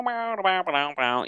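a high sung bow bow bow jingle; mouth-made segment jingle dropping down; improvised high sung transition sound; vocal transition sting. Pretend. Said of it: "We're over to".

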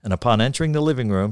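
plain reading voice

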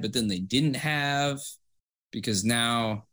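usual speaking voice

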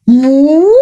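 loud drawn-out moo; cow moo. Acted. Cow mooing mid-speech.

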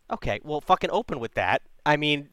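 usual speaking voice

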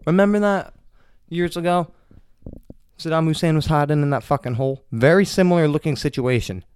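getting worked up over it; excited. Real, not acted. Excited Saddam comparison.

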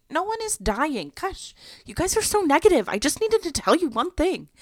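high-pitched retold whine at parents; imitating her exasperated self; exasperated protest. Exasperated reply to parents' guess.

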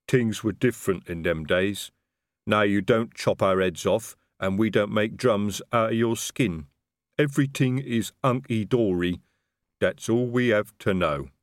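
slow, plodding troll voice; troll smoothing things over, unhurried; troll, conciliatory. Acted. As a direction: lay it out slowly as a troll.

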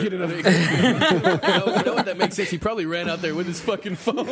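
cracking up as it clicks; laughing realization. Real, not acted. Host laughing at friend's phone theory.

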